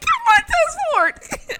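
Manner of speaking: very high, loud, drawn-out squeal; starstruck squeal. Retold or imitated. Fan tells Montez Ford who he is, squealing. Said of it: "Like, in that voice".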